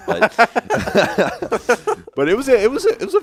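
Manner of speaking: high, loud laughing words. Real, not acted. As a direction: laugh through it. Laughing at a teammate's bad ult.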